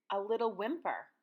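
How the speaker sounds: ordinary delivery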